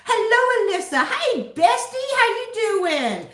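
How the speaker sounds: high, loud, slow sing-song hello; excited greeting. Real, not acted. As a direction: greet a best friend with delight. Greeting a friend in chat.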